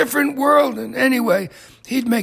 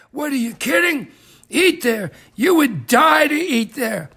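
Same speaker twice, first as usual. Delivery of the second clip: ordinary talk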